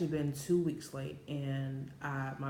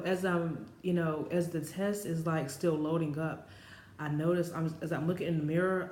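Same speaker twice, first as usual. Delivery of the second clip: usual speaking voice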